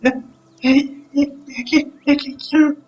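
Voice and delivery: put-on high child's voice calling for help; frightened caged child. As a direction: plead haltingly, like a small captive child. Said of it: "Vous entendez une voix". (voix aiguë d'enfant captif qui appelle à l'aide)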